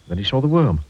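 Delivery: ordinary delivery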